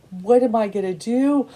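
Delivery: retold crying. Retelling a pregnant woman's TikTok video, mock tearful. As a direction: imitate a woman crying out, without real sobs. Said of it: "And she's crying".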